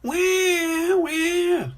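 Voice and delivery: high, loud cartoon "wah"; storybook baby cry; mock crying wail. A narrator's reading. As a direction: wail like a crying toddler, hammed-up. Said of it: "cries George".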